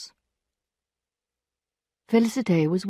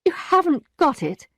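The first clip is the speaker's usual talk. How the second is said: higher, shocked question; stunned disbelief; 9 semitones higher than usual. A narrator's reading. Emma stunned that Rodolphe has no money. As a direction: repeat it in disbelief.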